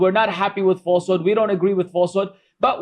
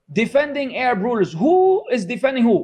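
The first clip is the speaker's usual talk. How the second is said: usual speaking voice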